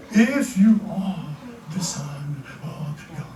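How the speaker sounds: slow, trailing to a hush; acted sly tempter, drawn out; insinuating tempter. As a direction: plant doubt slowly and softly. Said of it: "the enemy is right there".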